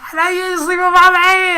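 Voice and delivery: high, loud, drawn-out comic screech; hammed-up jealous fury at full volume; mock outraged yell. Girlfriend confronts rival over her man, hammed-up yell. Acts out a woman starting a fight.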